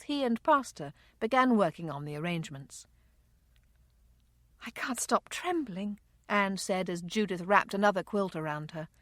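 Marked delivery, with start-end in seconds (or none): 4.6-6.0 soft, small distressed voice; hushed and upset; shaken grief. Acted. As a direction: admit it quietly, in shock. Grieving woman in shock, trembling.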